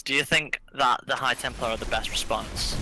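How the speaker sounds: ordinary talk, nothing marked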